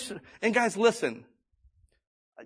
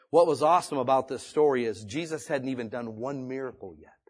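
ordinary delivery

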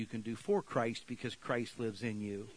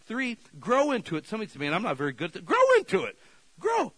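full-force preaching; 8 semitones higher, 8 dB louder, faster than usual. Real, not acted. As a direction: grow shouted very high and loud.

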